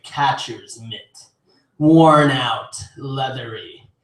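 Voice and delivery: slow, low, measured spoken-word reading; poem recitation. Acted. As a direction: recite it darkly, pausing after each image. Reads own poem aloud.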